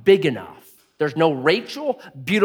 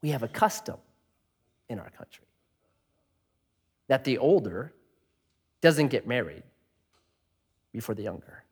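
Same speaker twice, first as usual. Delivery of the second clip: a smooth unbothered reply; calm Laban; 5 semitones lower, 9 dB quieter than usual. Retold or imitated. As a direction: Laban calmly cites custom to Jacob, quieter. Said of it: "what does Laban say".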